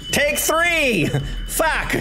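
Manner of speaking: high, frustrated yelp; exasperated outburst. Real, not acted. Gamer starting a third attempt, exasperated outburst. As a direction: announce the retry in high exasperation. Third try after failing.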